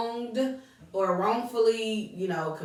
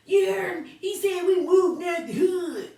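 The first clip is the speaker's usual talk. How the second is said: high, loud mocking imitation; exaggerated, pitched-up jeer; 9 semitones higher, 5 dB louder than usual. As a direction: mimic an onlooker making fun of someone. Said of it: "Like, don't mock him".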